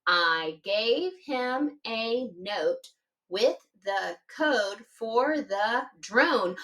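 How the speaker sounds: slow, word by word; counting words slowly. Shown as a demonstration. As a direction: space each word out to count it. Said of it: "Let's count 'em".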